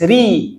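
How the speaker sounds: "Ri" with a light, thin r. Shown as a demonstration. Teacher corrects a student's kasrah, thin "Ri". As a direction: say "Ri" lightly, without thickening the r.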